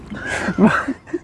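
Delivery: breathy, rising burst of laughter; real laugh. Real, not acted. Cracking up because the guy behind is funny, real laugh. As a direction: crack up softly mid-explanation. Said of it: "Je rigole parce que".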